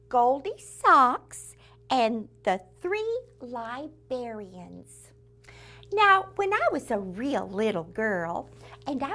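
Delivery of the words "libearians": "Librarians" with bear in place of brar; bear inside librarian. Shown as a demonstration. Host reading out a punning book title, "Librarians" said as lie-BEAR-ee-uns. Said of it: "want us to say librarian".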